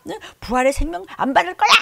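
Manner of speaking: loud high rebuke; scolding yell. Retold or imitated. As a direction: keep hammering them with questions. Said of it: "막 이러고 그냥".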